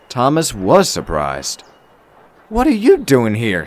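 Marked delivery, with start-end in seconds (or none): 2.5-3.7 higher, curious question. A narrator's reading. Thomas asks the woman who appeared in his shed, curious. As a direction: ask with open curiosity. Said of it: "he asked curiously".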